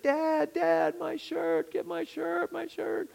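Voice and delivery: put-on high, wailing little boy's cry; crying child. Little son crying to his dad for his lost shirt, high wailing. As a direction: whine it like a sobbing five-year-old. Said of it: "He starts crying. He's like".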